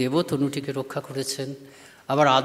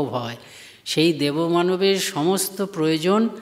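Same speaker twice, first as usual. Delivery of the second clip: slow, weighty sermon delivery, louder on key words; emphatic preaching; 4 dB louder, slower than usual. Acted. Monk explaining Gambhirananda's insight to devotees, slow emphatic preaching. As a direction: press each phrase home to the congregation.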